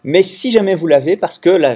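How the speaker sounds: ordinary delivery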